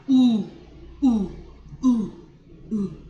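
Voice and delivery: "u" as a short, separate oo sound; unaccented U demonstration. Shown as a demonstration. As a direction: repeat a clean oo for "u". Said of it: "without an accent is".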